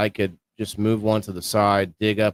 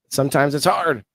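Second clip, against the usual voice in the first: higher, upbeat hype host voice; excited hype; 6 semitones higher, 3 dB louder than usual. Acted. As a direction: pump up the audience like a YouTuber. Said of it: "I try to be exciting".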